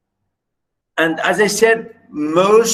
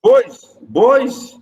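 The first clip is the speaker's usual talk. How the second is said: raised, higher voice calling the class; 6 semitones higher, 4 dB louder than usual. Real, not acted. Teacher checking silent students are there, calling out. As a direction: call sharply for their attention.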